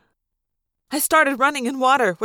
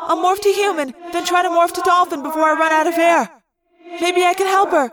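high, urgent yelled plan with echo; raised, pressing voice; Cassie; 4 semitones higher than usual. A narrator's reading. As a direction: call out the rescue plan fast and urgently. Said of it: "Cassie yelled".

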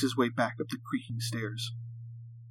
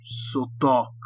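put-on captive with a heavy Russian accent; thick Russian accent. A narrator's reading. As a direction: speak English like a Russian prisoner. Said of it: "his thick Russian accent coming through".

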